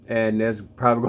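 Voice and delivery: ordinary delivery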